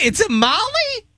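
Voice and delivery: very high, incredulous query; baffled question. Real, not acted. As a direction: pitch it way up in confusion. Baffled guess at the word.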